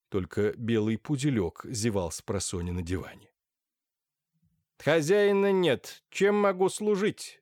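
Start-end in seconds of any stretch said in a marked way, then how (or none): 4.8-7.4 high, slow metallic speaker voice; a tinny high voice from nowhere. Acted. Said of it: "раздался высокий металлический голос".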